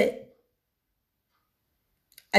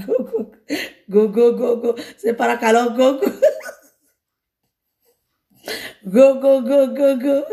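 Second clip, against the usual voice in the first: shouting. Retold or imitated. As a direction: chant go go with laughter. Repeating the crowd's shouts, laughing.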